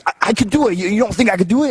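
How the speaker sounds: high put-on voice, insisting; mock insistent Scotty. Scotty insisting he can do the move, high put-on voice. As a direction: dig in stubbornly.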